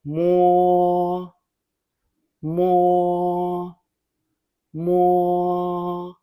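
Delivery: "Mo, mo, mo" held on a high level note; drawn-out first-tone "mo"; repeat-after-me drill. Shown as a demonstration. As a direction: sustain each "mo" evenly, pausing between them. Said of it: "Repeat after me".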